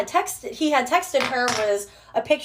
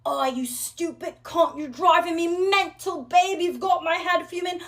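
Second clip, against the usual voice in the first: higher-pitched, hammed-up British accent; 7 semitones higher than usual. Answering a stalker's phone calls, indignant British accent. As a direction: scold in a put-on British accent. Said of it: "I'm fucking British".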